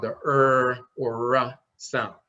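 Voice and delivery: "er" langzaam en gerekt; drawn-out er sound. Shown as a demonstration. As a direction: stretch "er or r" slowly. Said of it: "the symbol for the R sound".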